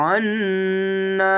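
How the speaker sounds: আন্না with the nn hummed through the nose, long and slow; held nasal hum ghunna. Shown as a demonstration. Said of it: "এভাবে গুন্নাগুলি হবে".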